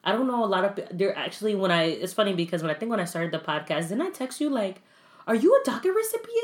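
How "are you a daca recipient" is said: very high-pitched, hammed-up nosy question; sudden squeaky quoted ask; blurted mock question. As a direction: squeal out a random question to a friend. Acting out a random text question.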